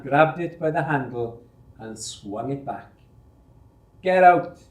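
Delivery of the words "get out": bellowed at full volume, higher and louder; furious shout. Acted. Charge hand bellowing at the three lads, furious shout. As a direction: roar it at the three lads to clear them out. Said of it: "he shouted at the top of his voice".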